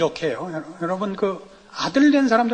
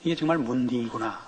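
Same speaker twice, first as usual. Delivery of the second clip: low Gyeongsang grumble, imitating the pastor; mock Gyeongsang muttering; lightly voiced Gyeongsang pastor; Gyeongsang dialect; 9 semitones lower, 5 dB quieter than usual. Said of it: "자기도 경상도면서".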